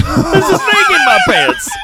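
squeaky high laughing repeat of the line; giggling through the movie line. Retold or imitated.

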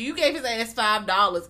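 ordinary delivery

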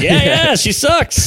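high-pitched, exasperated agreement; emphatic agreement. Retold or imitated. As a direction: agree hard, voice jumping up. Retells agreeing about his mom.

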